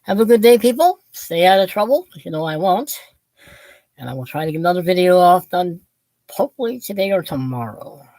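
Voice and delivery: ordinary talk, nothing marked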